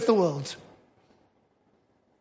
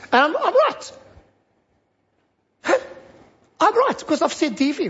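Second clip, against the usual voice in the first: smug self-assurance; 4 semitones higher than usual. Retold or imitated. Mocking a smug believer.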